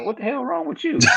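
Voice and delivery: high, laughing mock outburst; laughing at a friend's tears; incredulous quote. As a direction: toss it off laughing in disbelief. Mock yelling at crying coworker.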